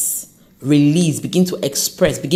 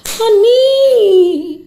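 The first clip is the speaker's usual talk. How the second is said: high, loud, drawn-out call; cooing reassurance; 5 dB louder than usual. Acted. Podcaster to a doubting job seeker, cooing reassurance. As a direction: sing it out high and long.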